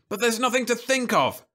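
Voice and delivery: high, angry shout; shouted angrily. A narrator's reading. Man shouting angrily at his wife. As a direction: yell it at her in frustration. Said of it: "he shouted angrily".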